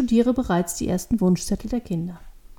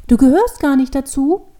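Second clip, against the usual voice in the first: put-on higher, loud childlike question; amazed child; 4 semitones higher, 9 dB louder than usual. A narrator's reading. Devil girl learns man and tree are separate, amazed. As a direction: ask it in open astonishment.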